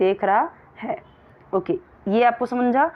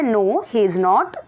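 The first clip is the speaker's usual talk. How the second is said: slow, clear classroom read; slow reading aloud; much slower than usual. Shown as a demonstration. Teacher reading the question to her pupils slowly. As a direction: read each word out very slowly.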